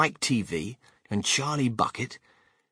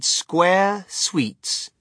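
very slow, drawn-out words, louder; reading out a sign; 3 semitones higher, 5 dB louder, much slower than usual. A narrator's reading. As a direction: announce each word slowly and grandly. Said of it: "it said".

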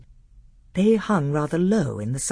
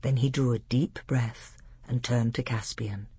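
ordinary delivery, nothing marked

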